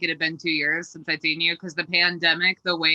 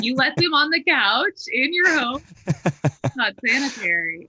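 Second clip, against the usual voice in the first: scandalized dog owner; 7 semitones higher than usual. Retold or imitated. Outside dog owners voiced.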